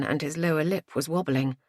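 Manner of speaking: usual speaking voice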